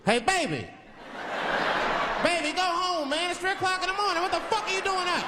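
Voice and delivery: high, strained holler to someone outside; calling out. Acted. Man in limousine calling to baby at night, high holler. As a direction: call out high, pitch held up.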